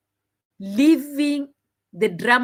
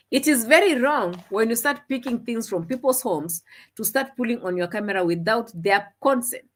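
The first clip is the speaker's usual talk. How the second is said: ordinary delivery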